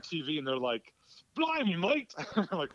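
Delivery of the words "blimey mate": high-pitched mock Cockney outburst; mock Cockney accent. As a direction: imitate a British actress's Cockney, pitched way up. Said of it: "She's super cockney in real life".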